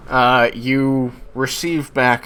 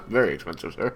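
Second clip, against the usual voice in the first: laughing through the line; giggling servant reply; 4 semitones higher, faster than usual. Acted. Butler NPC answers, laughing.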